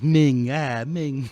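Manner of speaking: drawn-out Italian American "Ming, ah, Ming"; Italian American exclamation. Retold or imitated. Quoting the coworker's exclamation in an Italian American accent. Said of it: "he says".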